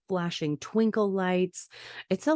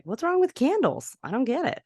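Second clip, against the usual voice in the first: high-pitched, puzzled child imitation; baffled questioning; 7 semitones higher than usual. Sydney asking the others about candles, baffled. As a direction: swoop up high in mock bewilderment.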